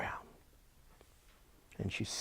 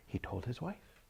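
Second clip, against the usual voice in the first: ordinary delivery, nothing marked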